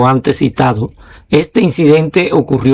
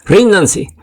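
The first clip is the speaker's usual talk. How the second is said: understated higher-pitched command; narrated shout; 6 semitones higher than usual. A narrator's reading. Lieutenant ordering the enemy to surrender, restrained narrated shout. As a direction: read the surrender order lifted, without truly shouting. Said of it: "gritó con voz fuerte".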